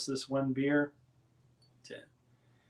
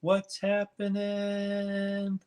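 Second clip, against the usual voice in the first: drawn-out greeting; 8 semitones higher, 4 dB louder, much slower than usual. Real, not acted. Greeting a viewer in chat.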